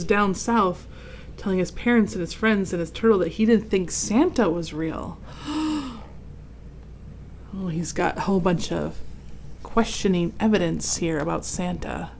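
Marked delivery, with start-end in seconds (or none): 5.2-7.5 gasp. Acted. Gasp at surprising story turn.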